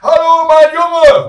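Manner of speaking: slow, very high bellow; shouting granny. Acted. Grandmother greeting grandson by phone, drawn-out high shout. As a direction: holler it as if no telephone were needed. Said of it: "Sie schreit so laut".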